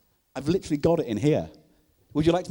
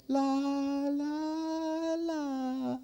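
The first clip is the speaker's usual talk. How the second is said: put-on higher sing-song la la la of a small girl; little girl's voice; 11 semitones higher, 6 dB quieter, much slower than usual. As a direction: croon it like a ghostly little girl. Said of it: "I hear this little girl's voice go".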